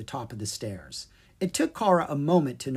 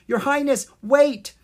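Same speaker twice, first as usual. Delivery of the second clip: high, loud call after her; 12 semitones higher, 8 dB louder than usual. Acted. Kara calling after the departing princess, high and loud. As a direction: call her back before she leaves.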